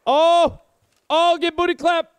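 loud, high-pitched crowing; triumphant shout. Real, not acted. Gamer crowing over a downed enemy, triumphant shout. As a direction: yell it out over the kill.

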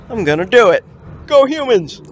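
loud, pumped-up cheer; rallying shout. Acted.

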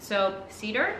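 ordinary delivery

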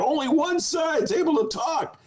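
mock the congressman in a high, whiny voice. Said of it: "This dude's up there going".

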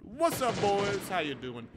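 high cheeky hello; mock greeting. Greeting enemies mockingly.

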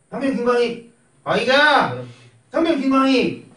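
mock military call-out. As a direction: bark 상병 김광희 like a private reporting in. Drilling a junior to self-report.

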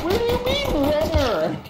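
very high-pitched, incredulous yelp; exasperated yell. Real, not acted. Player answering a clueless teammate, high exasperated yell. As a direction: fire back in disbelief, pitch way up.

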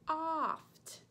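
oft said slowly with an open "aw" vowel, like "awft"; sounding out oft. Shown as a demonstration. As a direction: stretch the vowel of oft so the kids hear "aw". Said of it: "if you listen carefully, you will hear the aw sound".

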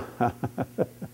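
low-level chuckle; soft laugh. Real, not acted. Preacher spent at sermon's end, soft chuckle. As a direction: chuckle softly to yourself.

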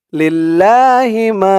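লিল্লাহি মা with মা held one beat; slow recited লিল্লাহি মা, stretching মা; drawn-out মা; one-alif stretch. Shown as a demonstration. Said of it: "মা এক আলিফ টান".

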